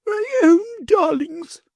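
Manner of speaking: high, slow, mock-tender coo; sugary lady. A narrator's reading. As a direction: coo falsely sweet at the children. False tenderness to children.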